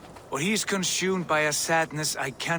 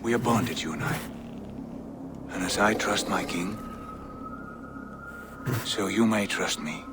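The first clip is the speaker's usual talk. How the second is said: gentle plea; 7 semitones lower than usual. Acted. The visitor gently begs the wolf to trust him, lower tender voice. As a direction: soothe the wolf like an old friend.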